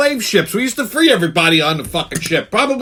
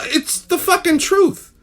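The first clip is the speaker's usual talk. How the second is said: usual speaking voice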